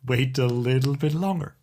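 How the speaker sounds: normal talking voice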